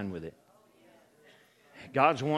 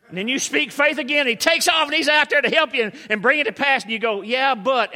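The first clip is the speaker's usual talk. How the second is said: loud, raised-pitch animated preaching; preaching at full force; 11 semitones higher, 10 dB louder than usual. Acted. Preacher acting out faith and doubt for the congregation, full-force preaching. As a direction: drive the story home with big energy.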